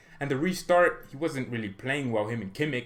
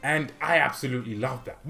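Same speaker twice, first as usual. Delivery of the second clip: normal talking voice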